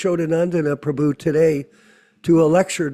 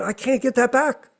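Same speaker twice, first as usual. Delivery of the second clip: higher, stricken little cry of loss; mock dismay; 6 semitones higher than usual. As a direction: act out the panic of lost work. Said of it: "You're going".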